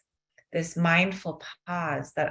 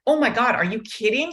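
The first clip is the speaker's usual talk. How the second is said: raised, louder incredulous snap; high exasperated disbelief; mock exasperated outburst; 8 semitones higher, 6 dB louder than usual. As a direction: retell his near-explosion of anger, hammed up a bit. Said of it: "He almost explodes in anger".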